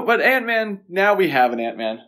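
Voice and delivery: normal talking voice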